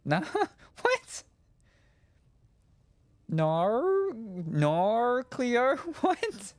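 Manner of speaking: high squeaky "Nar, Cleo?" broken by laughs; laughing squeaky mock. Retelling an in-joke, high and giggling.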